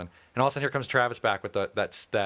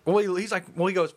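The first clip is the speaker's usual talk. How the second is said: usual speaking voice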